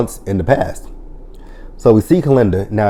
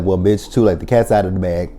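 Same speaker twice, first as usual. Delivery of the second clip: usual speaking voice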